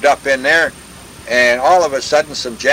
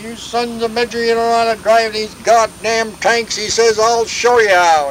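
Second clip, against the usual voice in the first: higher-pitched retold cussing out; angry officer; 8 semitones higher than usual. Officer cusses out tank crew, mock-angry, high voice. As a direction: act out a fed-up officer taking charge. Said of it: "He was really mad".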